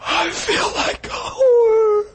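very high, slow wailing cry; sobbing wife. Retold or imitated. Wife confessing to the pastor, sobbing high and slow. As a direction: weep it out in shame.

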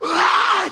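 high-pitched imitated sob; mock wail. Wife falling on her husband, wailing. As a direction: wail like someone breaking down.

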